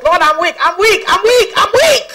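triumphant playful boast, rising each time; mock boasting prayer. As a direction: boast of weakness with rising glee. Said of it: "let me boast".

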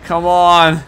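loud, high exasperation; frustrated protest. Real, not acted. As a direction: cry out at a blown chance. Missed, target escapes.

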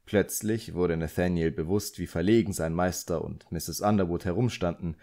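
usual speaking voice

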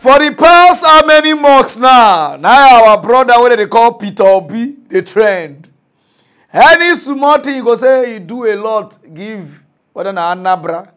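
loud, worked-up rant; excited shouting. Real, not acted. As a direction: shout it out, fired up. Excited rant about Peter Obi.

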